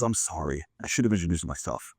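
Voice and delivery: low, soft, unhurried apology; understated apology. Acted. Investor introduces himself to a stranger, understated and low. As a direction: drop the voice, lightly apologetic.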